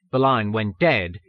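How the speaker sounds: plain reading voice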